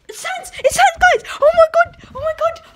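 high pretend panic shout; hammed-up frightened yell; mock scream. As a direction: scream like you just saw him. Spots Sans, screams.

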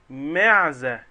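معزة called out loud and falling, stressing the first syllable; a teacher's loud model of the word; emphatic answer. Shown as a demonstration. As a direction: belt out معزة for the class. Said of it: "مين يقدر يجيب لي كلمة تانية فيها حرف الميم".

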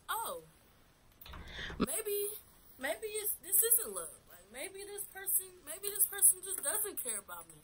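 high doubting inner voice. Retold or imitated. Voices own doubting thoughts.

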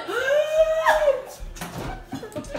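sharp gasp. Real, not acted. Mouth on fire from chili.

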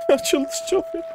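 squealing laugh into a high whine; hysterical laugh-whine. Real, not acted. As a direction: laugh like he's losing his mind. Going crazy from the wolves.

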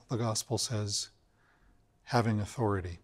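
ordinary talk, nothing marked

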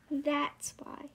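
usual speaking voice

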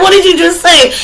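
high-pitched, playfully overexcited demand; worked-up question. Acted. Reacting to a sudden aha moment, worked-up question. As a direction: blurt it high, with laughter just before. Acts the worked-up version, after laugh.

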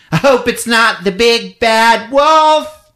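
put-on high, slow, breathy-girl parody of the heroine; mock damsel, high and slow. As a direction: coo it like a B-movie bombshell. Host mocks Peggy's lines.

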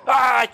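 high, loud "ai"; sudden sharp cry; startled shriek. Real, not acted. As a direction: yelp as if stabbed. Killed while using knife.

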